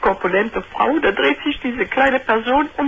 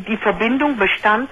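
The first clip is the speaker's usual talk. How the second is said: ordinary delivery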